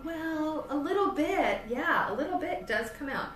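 a lightly voiced pregnant caller; imitating a patient. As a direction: play the unsure patient on the phone. Voicing a caller's hesitant answer.

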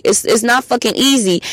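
ordinary delivery

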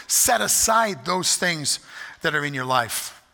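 ordinary talk

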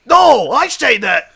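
high, loud shouted objection; hammed-up indignant yell; outraged contradiction. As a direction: shout them down. Detective contradicts witness in quarrel.

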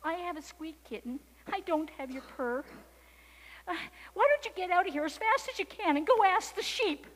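nervous mouse. A narrator's reading. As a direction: start small, end high, loud and urgent.

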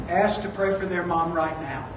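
low, soft nudge; quiet inner prompting. Retold or imitated. Holy Spirit nudging you to pray, quiet prompting.

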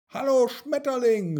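high, loud eager hello; calling out. A narrator's reading. Mia chases a butterfly and greets it, calling out. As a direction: call after someone flitting away. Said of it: "rief sie".

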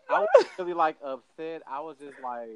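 usual speaking voice

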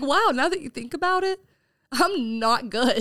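half-joking confession to a customer; mock realization. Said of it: "I'm like".

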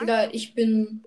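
ordinary talk, nothing marked

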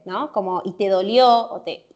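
usual speaking voice

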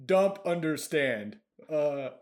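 normal talking voice

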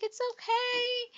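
high, drawn-out comforting coo; jolly reassurance. Retold or imitated. Stranger comforting a tearful woman, high jolly reassurance. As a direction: imitate a kindly stranger, slow and high. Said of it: "she's very jolly".

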